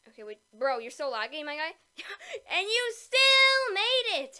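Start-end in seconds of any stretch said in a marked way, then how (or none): 2.0-4.4 slow, loud disbelief; stunned. Real, not acted. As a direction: drag it out in disbelief. Laggy teammate somehow survives.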